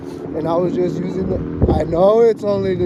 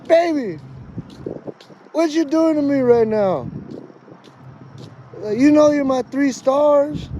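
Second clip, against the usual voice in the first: whiny high plea; 7 semitones higher than usual. Retold or imitated. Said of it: "I'm like".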